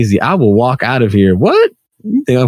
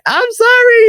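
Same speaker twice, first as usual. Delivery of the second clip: much higher mock apology; apologetic woman. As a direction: squeak out a sorry.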